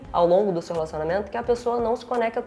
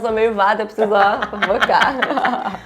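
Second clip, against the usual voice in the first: laughing; 2 semitones higher, 7 dB louder than usual. Real, not acted. Jokes about being scatterbrained.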